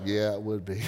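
sheepish admission. Retold or imitated. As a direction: mumble it like a guilty yes. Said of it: "We all like".

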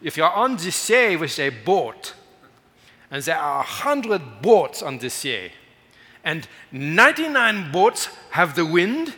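loud, high, slow German evangelist; booming, drawn-out German-accented proclamation. Acted. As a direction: preach it big in a German accent. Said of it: "he says in his German sac-, accent".

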